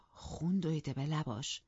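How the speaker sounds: plain reading voice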